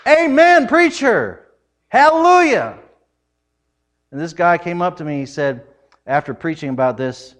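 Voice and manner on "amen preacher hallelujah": high loud church response; call-and-response. Retold or imitated. Churchgoer calling back to the preacher, loud and high. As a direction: answer the preacher with fervour. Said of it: "during the service say".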